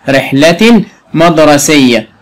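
رحلة مدرسية with the final tanween sounded as a clear n; nunation shown. Shown as a demonstration. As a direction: pronounce رحلة مدرسية as rihlatin madrasiyya. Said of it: "تنطق نون ساكنة".

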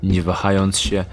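ordinary talk

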